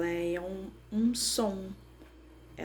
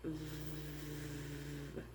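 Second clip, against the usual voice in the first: long, soft buzzing "vvv"; sustained V sound; 3 semitones lower, 11 dB quieter than usual. Shown as a demonstration. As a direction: hold the V as a continuous hum on the lip. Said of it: "O V". (som do V prolongado, "vvv")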